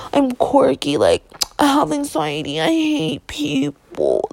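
high, slow mock quirky girl. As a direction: ham up the quirky anxious girl. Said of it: "I just don't say it to be like".

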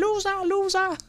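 very high-pitched imitation of a caller; mock roll-call holler; calling out a name. Man calls out her name, retold again.

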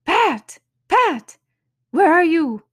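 high, loud furious shout for Pat; angry calling. Acted. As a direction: yell for the servant, fuming. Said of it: "Next came an angry voice".

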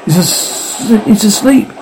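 stammer, breaking off mid-word and restarting. A narrator's reading. Man finding his companion has fallen asleep, stammering. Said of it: "he stammered".

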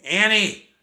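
loud call, pitched high; calling for a missing sister. A narrator's reading. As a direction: shout her name across the house. Jack calls for missing sister.

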